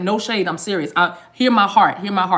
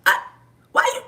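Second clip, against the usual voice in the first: usual speaking voice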